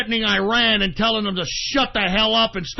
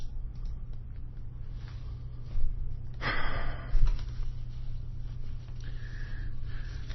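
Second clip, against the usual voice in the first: very long, soft exhale; exhausted sigh; 9 dB quieter than usual. Real, not acted. Broadcaster spent after a rant, long drained sigh. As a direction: breathe out after blowing up.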